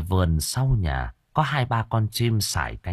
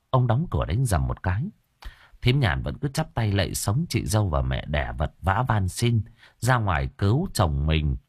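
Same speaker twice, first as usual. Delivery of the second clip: ordinary delivery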